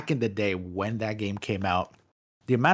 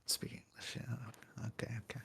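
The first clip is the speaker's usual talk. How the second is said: hushed unvoiced whisper; whispered embarrassed aside; 19 dB quieter than usual. Real, not acted. Whispered aside after joke.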